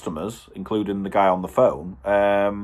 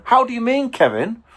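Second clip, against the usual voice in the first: incredulous; 13 semitones higher than usual. Retold or imitated. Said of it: "And I'm going".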